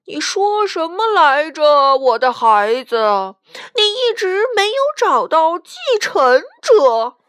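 loud ghostly uncle demanding an answer; stern spirit's loud interrogation; questioning ghost. A narrator's reading. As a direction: boom it out, climbing high on the reproach. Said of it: "鬼魂用低沉的声音问道".

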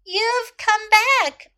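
high, loud, drawn-out exclamation; joyful cry. A narrator's reading. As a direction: cry out slowly with joy at a reunion. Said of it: "cried Chloe".

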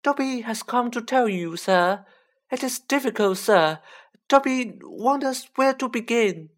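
high-pitched, earnest elf voice; earnest Dobby. A narrator's reading. Elf unsure where to begin, earnest and high. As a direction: squeak it out earnestly. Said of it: "said Dobby earnestly".

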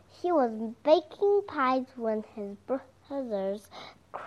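plain reading voice

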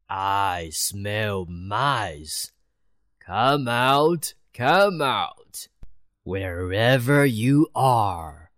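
a cat luring mice out of hiding; prowling cat. Acted.

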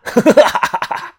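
嚣张的气声大笑; 哈哈狂笑一串; 放肆大笑. A narrator's reading. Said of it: "继续的大笑的叫嚣道". (挑衅的大笑)

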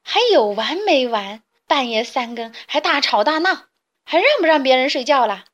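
woken and furious, loud and fast; angry loud complaint. Acted. Woken chick yells at the chatterers to shut up, furious. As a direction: yell at the noisy kids. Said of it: "大声地喊".

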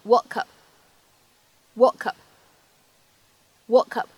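"woke up" linked as wokup; linking. Shown as a demonstration. Teacher demonstrating linking for learners. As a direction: run "woke up" together smoothly. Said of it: "会有一个非常自然的连读".

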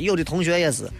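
ordinary delivery

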